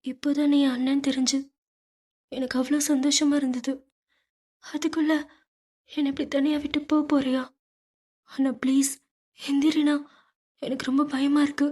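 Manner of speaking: frightened sister crying over her brother. A narrator's reading. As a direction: beg him to wake up, crying. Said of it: "அழுது கொண்டே எழுப்பிவிட்டு".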